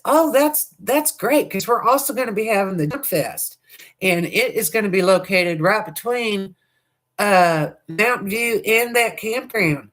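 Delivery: high, sweet Southern lady voice, drawn out; sweet Southern drawl. Retold or imitated. Vlogger quotes the chamber lady about Junk Fest, sweet high Southern drawl. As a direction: imitate a sugary Southern drawl, affectionately not mocking. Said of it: "She just really talked sweet, you know, Southern".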